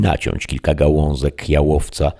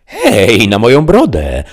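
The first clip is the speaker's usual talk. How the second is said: high, loud cry of delight; excited exclamation; 6 semitones higher, 6 dB louder than usual. A narrator's reading. Sancho exclaims after hearing the letter, loud. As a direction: burst out in admiration. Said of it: "zawołał Sancho".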